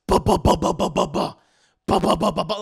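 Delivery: loud, rapid percussive "ba-ba-ba" knocks; imitated door banging. Recapping the banging on the front door, loud imitated knocks. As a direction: pound out the banging with your voice. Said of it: "we just hear this".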